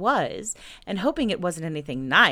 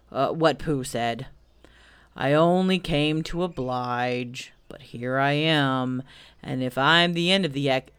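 gloomy Eeyore; 3 semitones lower than usual. Acted. Eeyore complains about the expedition, low and gloomy. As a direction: plod wearily through a complaint, halting on hard words.

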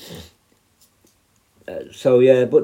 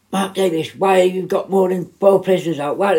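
high mock nagging manager; 6 semitones higher than usual. Prison officer mimics manager scolding him, higher nagging voice. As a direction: imitate her nagging, pitched up. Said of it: "And she's going".

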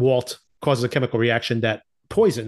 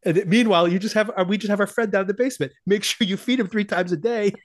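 higher, faster sarcastic jab; pitched-up snarky aside; sarcastic mock instructions; 8 semitones higher than usual. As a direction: toss off the order with bitter sarcasm. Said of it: "Jesse's sarcasm continues".

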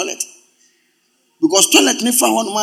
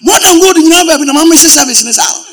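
excited exclaim; 8 semitones higher, 6 dB louder than usual. Retold or imitated.

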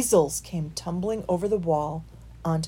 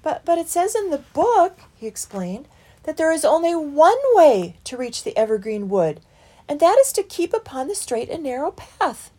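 concerned; 9 semitones higher, 7 dB louder than usual. A narrator's reading. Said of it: "Christopher was concerned".

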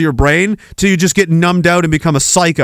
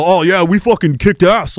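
mocking sports fan. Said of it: "you see how they talk like this?".

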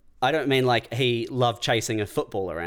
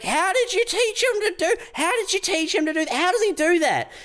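very high, incredulous demanding voice; high-pitched astonished questioning; mock freak-out. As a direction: imitate stunned strangers losing it. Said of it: "people would freak the fuck out".

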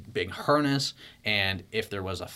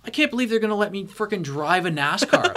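high, louder laughing thrill; laughing disbelief; 9 semitones higher, 6 dB louder than usual. Retold or imitated. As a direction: laugh with amazed glee. Retold thrilled first thought.

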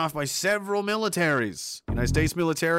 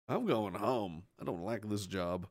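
defeated mutter; 9 semitones lower, 9 dB quieter than usual. Acted.

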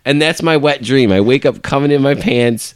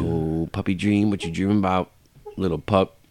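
puppy talk; 4 semitones lower, 7 dB quieter than usual. Real, not acted. Talking to sleeping puppy.

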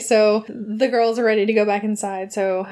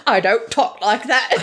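badly faked English accent, veering Cockney; hammy attempt at an English film-star accent; Cary Grant. Shown as a demonstration. Cary Grant objecting to the imitation, shaky-aim Cockney-ish English accent. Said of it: "I can't do the voice".

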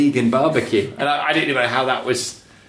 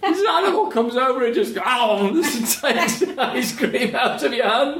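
high laughing voice, slowed by giggles; laughing while telling it; 10 semitones higher than usual. Real, not acted. Laughing retelling of dog stealing.

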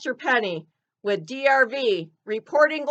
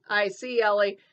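ordinary delivery

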